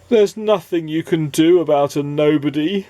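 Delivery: a lightly weepy character voice; weeping. Acted. A nobody despairing to Mister Happy, lightly weepy. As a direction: mourn it through tears. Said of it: "wept the person".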